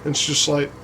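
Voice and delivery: ordinary delivery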